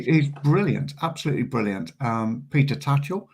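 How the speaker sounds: ordinary delivery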